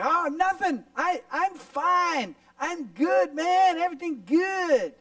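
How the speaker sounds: high, loud, overdone reassurance; put-on cheerfulness. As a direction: insist too hard that all is fine. Acted denial that anything is wrong.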